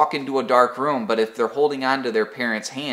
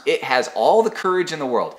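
ordinary delivery

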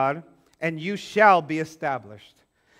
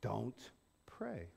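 hushed voice of God; 10 semitones lower, 21 dB quieter than usual. Retold or imitated. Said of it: "all of a sudden".

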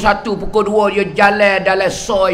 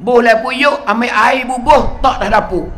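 ordinary talk

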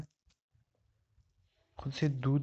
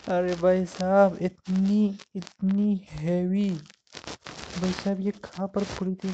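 loud, higher amazed laugh into words; stunned and amused, the last phrase slow; real astonished chuckle, louder; laughing surprise; 4 semitones higher, 5 dB louder, slower than usual. Real, not acted. Surprised by a heavy purse.

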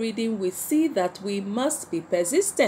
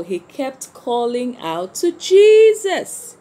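louder, slower, leaning on "he kept calling out to Jesus"; emphatic, louder and slower; 3 semitones higher, 8 dB louder than usual. Acted. Teacher to children, stressing Bartimaeus' persistence, louder and slower. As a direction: drive the point home to the class.

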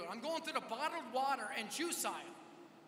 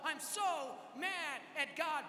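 high, slow retold cry of anger; tearful outburst; 4 semitones higher, much slower than usual. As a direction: stretch it out like an upset woman's shout. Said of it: "just bursts out into tears".

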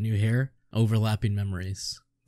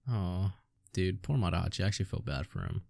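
pity; 4 semitones lower, 9 dB quieter than usual. Real, not acted. Pities the character.

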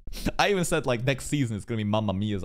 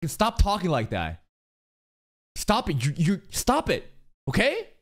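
a light imitation of a brother scolding, rising higher on the later lines; mock scolding brother; 7 semitones higher than usual. Imitating the brother telling his sister to drop the cat puns, mildly stern. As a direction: sternly tell her to stop, in a half-hearted imitation. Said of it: "her bro said".

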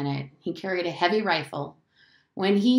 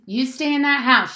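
sharp loud order, pitched up; yelled; 6 semitones higher, 7 dB louder than usual. A narrator's reading. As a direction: yell the children back indoors. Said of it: "she yelled".

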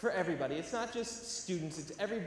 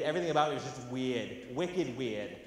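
ordinary talk

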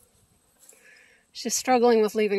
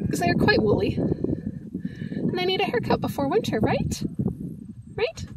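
pet talk; 7 semitones higher than usual. Real, not acted. Talking sweetly to the goats.

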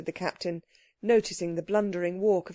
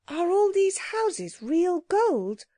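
put-on high, slow childlike question; wondering child; 11 semitones higher, slower than usual. A narrator's reading. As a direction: ask in drawn-out wonder, high-voiced. Child asks about gold houses.

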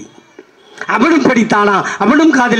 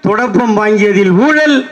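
normal talking voice

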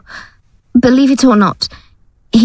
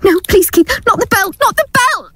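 high, rising panicked scream; terrified scream; 9 semitones higher than usual. Acted. Gail begs Keith not to use the belt, panicked scream. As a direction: beg in terror, building to a scream.

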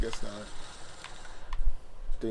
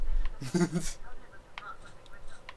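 whispered giggle; 5 semitones higher, 6 dB quieter than usual. Real, not acted. Laugh after game kill.

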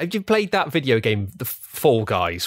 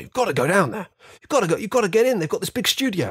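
higher, mock-posh eager gentleman; posh older man; 10 semitones higher, faster than usual. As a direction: imitate a posh fifty-something enthusing. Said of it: "this, like, posh man in his fifties".

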